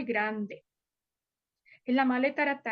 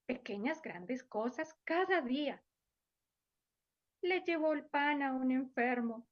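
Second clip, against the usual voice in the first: put-on softer, gentle voice for children; soft story reading; 6 dB quieter than usual. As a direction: tell it softly to little ones. (cuento suave para niños)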